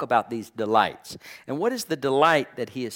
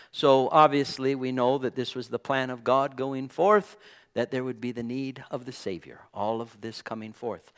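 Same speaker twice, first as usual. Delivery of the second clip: usual speaking voice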